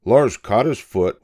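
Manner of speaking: plain reading voice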